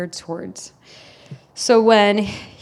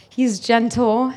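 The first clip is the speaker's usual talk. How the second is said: usual speaking voice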